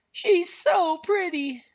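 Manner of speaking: very high, drawn-out admiring sigh; high, slow, admiring snowman voice; Olaf sighing admiringly. A narrator's reading. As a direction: swoon slowly in a high cartoon voice. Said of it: "Olaf sighed admiringly".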